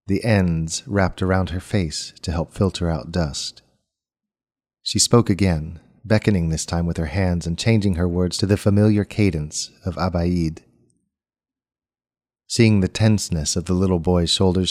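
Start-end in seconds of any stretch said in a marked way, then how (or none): none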